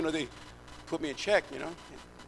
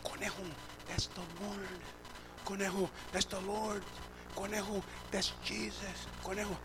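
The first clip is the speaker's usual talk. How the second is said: hushed, much softer voice; imitated whisper; 3 semitones higher, 9 dB quieter than usual. Joe Lugo praying over the preacher, imitated whisper. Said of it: "in a whisper, like".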